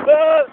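loud sustained yell; loud scream. Real, not acted. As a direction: scream at full volume. Scream on the ride. (grito alto)

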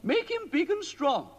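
high, fussy queenly voice; a worried queen pleading with the wizard. A narrator's reading. Queen complaining to Mister Ben about the king, high queenly voice.

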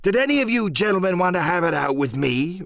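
high-pitched pirate challenge, mildly roared; blustering sea-dog captain; roaring. Acted. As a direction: challenge the whole crew. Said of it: "roared Silver".

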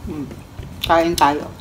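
talking between bites; with food in mouth; chewing while talking. Real, not acted. Seen 2: Holds chicken heart at mouth, bites and chews while talking.

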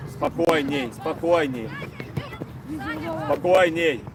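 low, soothing call to settle down; calm them down, voice low. Real, not acted. Coach calming his player. (низко и успокаивающе)

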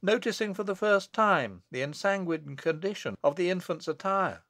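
ordinary delivery, nothing marked

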